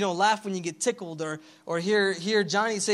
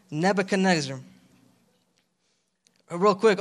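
normal talking voice

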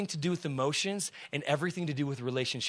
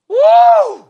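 very high, loud whoop of joy; preacher's shouted woo; exultant woo; 22 dB louder than usual. Real, not acted.

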